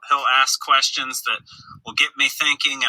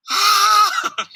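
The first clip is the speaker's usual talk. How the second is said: high helpless burst of laughter; 4 dB louder than usual. Real, not acted. Dad recalling his disastrous morning, high helpless laugh. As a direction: crack up at the chaos.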